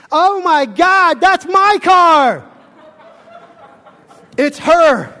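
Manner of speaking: high, loud, slow mock shriek of a woman; a woman's voice, high and slow, on the edge of a shout; shrill woman's voice. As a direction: play the lady shocked at her car. Lady recognizes her hit car.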